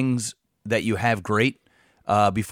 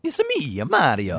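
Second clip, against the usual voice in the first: Mario voice. Pretend. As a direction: pop up into a cartoon Mario. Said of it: "who is the voice of Mario".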